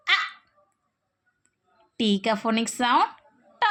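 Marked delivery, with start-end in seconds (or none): none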